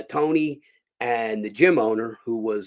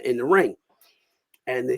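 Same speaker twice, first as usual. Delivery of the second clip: ordinary delivery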